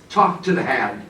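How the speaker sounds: mock brush-off with a laugh; sassy dismissal. Teacher mocking a defensive listener, sassy. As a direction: throw the old slang line, laughing. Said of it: "invoke a nineteen nineties cultural reference".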